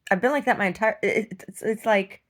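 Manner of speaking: normal talking voice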